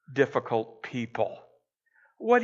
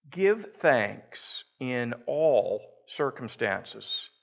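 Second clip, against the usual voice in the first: slow reflective reading; 3 semitones lower, much slower than usual. Shown as a demonstration. Repeats the verse to reflect.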